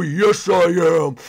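ordinary delivery

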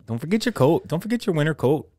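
high Midwest mom nagging voice; singsong Minnesota fussing; Minnesota accent. Pretend. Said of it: "I would talk in a Wisconsin accent".

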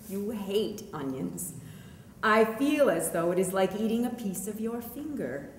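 usual speaking voice

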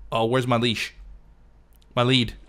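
normal talking voice

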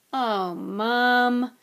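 loud, drawn groan of complaint; protesting whine. Acted. Son objecting to mother's garden order, whining. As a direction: whine at mother about the chore.

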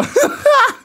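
very high-pitched burst of laughter; real high cackle of disbelief; incredulous laugh. Real, not acted.

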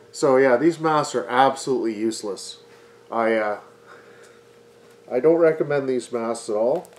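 muffled behind paper mask; speaking with covered mouth; through a dust mask. Real, not acted.

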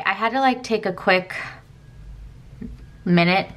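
ordinary talk

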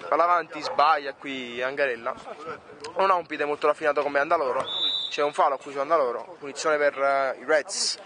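live play-by-play commentator; sports commentary. Acted. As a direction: call the match as it happens. Match play-by-play.

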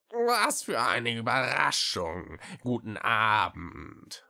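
langgezogen, wie mit vollem Hals; choking the greeting out slowly; würgend. A narrator's reading. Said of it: "wünschte sie würgend". (würgend und gedehnt begrüßen)

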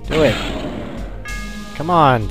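usual speaking voice

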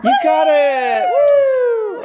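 high, loud cheering; excited cheer. Real, not acted. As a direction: whoop it up for the winner. Cheering a bingo win.

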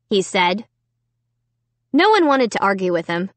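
usual speaking voice

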